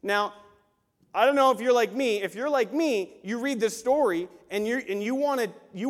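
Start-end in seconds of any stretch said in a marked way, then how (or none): none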